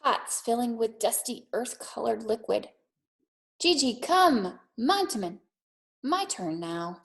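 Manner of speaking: put-on gentle read-aloud for children; calm storytelling pace for a classroom; storybook reading. A narrator's reading. Teacher reads picture book aloud.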